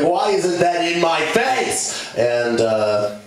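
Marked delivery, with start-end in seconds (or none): 0.0-1.9 high, drawn-out whine; slow high-pitched mock outrage; demanding monkey kid.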